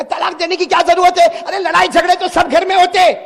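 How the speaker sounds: high, loud brushing it off; loud scoffing reassurance; loud dismissive. Retold or imitated. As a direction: wave off his worry at full voice. Preacher loudly dismisses the worry.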